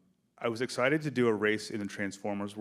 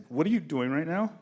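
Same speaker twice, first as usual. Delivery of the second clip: higher and louder, stunned; amazed exclamation; 7 semitones higher, 6 dB louder than usual. Retold or imitated. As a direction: blurt it out, blown away by the take. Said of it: "and I was like".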